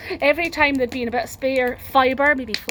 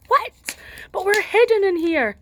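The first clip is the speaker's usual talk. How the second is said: higher startled what; alarmed villagers; 9 semitones higher, 4 dB louder than usual. Acted. Villagers startled by the shout, higher alarmed voices. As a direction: gasp in disbelief.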